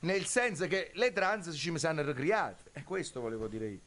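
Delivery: usual speaking voice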